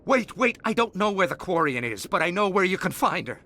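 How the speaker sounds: desperate pleading. Acted. Thug pleads under threat.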